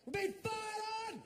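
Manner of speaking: hammed-up alarm, voice jumping up high; freak-out. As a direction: shriek it as if bullets are hitting you. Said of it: "once again, a freak out".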